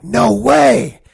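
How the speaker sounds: loud, high burst of disbelief; stunned disbelief. Retold or imitated. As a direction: shout it like you can't believe the news. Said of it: "I'm like".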